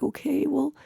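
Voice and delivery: soft, slightly high dog voice; hesitant dog's voice. Retold or imitated. Narrator voicing Sebastian the dog, soft and hesitant. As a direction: voice a dog quietly giving in. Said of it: "he's just like".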